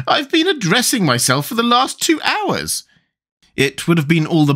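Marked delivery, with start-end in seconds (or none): 0.1-2.8 high, plaintive queen's voice; groaning complaint. A narrator's reading. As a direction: complain pitifully, pitched well up. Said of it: "groaned the poor Queen".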